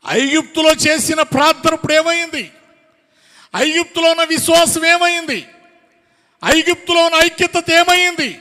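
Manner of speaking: high, loud, pressing rhetorical questions; full-force preaching. Real, not acted. Preacher demands where their unity and love went, full-force preaching. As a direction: hurl each question at the congregation.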